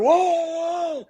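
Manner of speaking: high, loud whoa; retold startled calming-down; alarmed intervention. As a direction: imitate someone jumping in to stop a fight. Hanks stepping in to stop fight.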